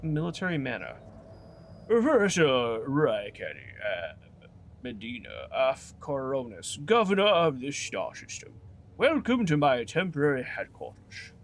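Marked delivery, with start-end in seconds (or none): 1.9-11.3 lightly voiced posh English governor; a touch of a posh accent, crisp and formal. Player voicing the governor to the party in a light posh accent. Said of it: "I don't know why I'm making him posh".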